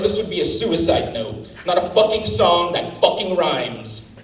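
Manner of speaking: ordinary talk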